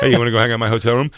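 rushed sleazy pickup line; fast mock come-on. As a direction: rattle off the come-on. Mock demon flirting.

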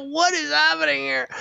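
high squeaky disbelief; mock baffled squeak. Watching the choking player, high baffled squeak. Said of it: "I go".

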